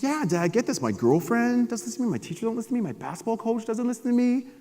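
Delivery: put-on high teenage complaining; whiny teen. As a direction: complain like a moody teen boy. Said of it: "I was like".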